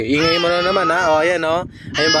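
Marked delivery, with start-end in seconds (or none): none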